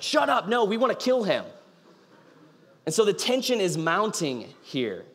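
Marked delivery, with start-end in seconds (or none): 0.0-1.5 higher, exasperated mock shout; angry outburst. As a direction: snap back at the lone voice of reason. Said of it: "And you're just like".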